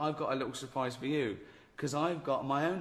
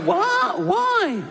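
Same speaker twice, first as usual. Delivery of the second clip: shrieking squeak of disbelief, much higher; shocked doctor; 10 dB louder than usual. Retold or imitated. Doctor shocked in retold story.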